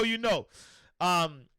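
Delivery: normal talking voice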